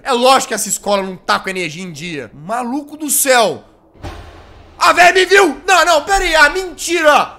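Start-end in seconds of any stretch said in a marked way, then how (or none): 4.8-7.3 high, loud alarmed cry; panicked shout. Real, not acted. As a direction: yelp in panic on being spotted. Spotted by the nun.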